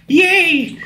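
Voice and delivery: high, drawn-out little cheer; imitation of a gleeful squeal; mock cheer. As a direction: stretch out a high-pitched, playful yay.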